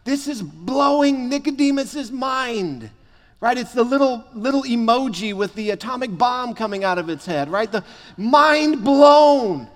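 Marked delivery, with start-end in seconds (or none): none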